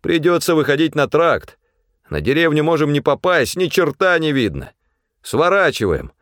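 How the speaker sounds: high, decisive instructions; taking charge. A narrator's reading. Pavel directing the lost men to turn, taking charge. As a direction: give orders against the storm. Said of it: "распорядился он".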